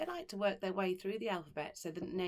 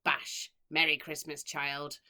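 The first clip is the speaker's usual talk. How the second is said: loud gleeful cruel matron; gleeful exclaim; 4 semitones lower, 6 dB louder, slower than usual. Acted. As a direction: crow it with each whack. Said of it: "would exclaim with glee".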